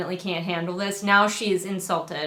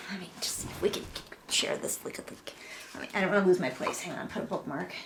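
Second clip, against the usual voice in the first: muttered aside; 2 semitones lower, 11 dB quieter, much faster than usual. Real, not acted. Fiddling with book aside.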